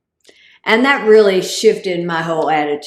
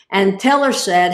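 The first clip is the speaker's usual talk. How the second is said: ordinary talk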